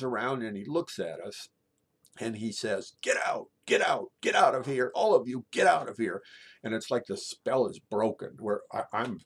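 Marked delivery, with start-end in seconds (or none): 3.0-6.2 loud, forceful order to clear out; commanding shout. Retold or imitated. Vice principal orders the stunned class out, commanding shout. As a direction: shout it urgently, much louder.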